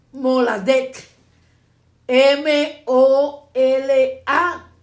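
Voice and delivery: very slow, letter by letter; loud, widely spaced letters; spelling out. Shown as a demonstration. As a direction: spell it firmly for people writing it down. Spelling a word for note-takers.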